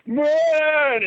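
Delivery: high, long bellowing wail; imitated cow scream. Cow screaming under attack, high imitated bellow. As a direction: mimic a panicked cow with one sustained high cry. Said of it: "This cow is freaking screaming".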